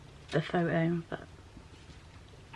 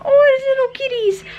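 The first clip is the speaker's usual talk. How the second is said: excited squeal; 16 dB louder than usual. Real, not acted. Sees cats on TV.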